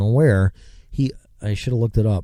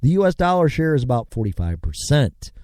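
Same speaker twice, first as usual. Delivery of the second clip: usual speaking voice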